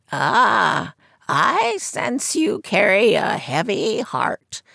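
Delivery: put-on high croaky old seer's voice; croaking fortune teller. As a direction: croak it from behind a curtain. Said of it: "she said".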